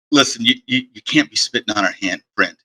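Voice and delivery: low, soft halting aside; hushed caution. Acted. Guard takes Rygar aside about spitting, low hushed caution. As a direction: caution them under your breath, stumbling a little.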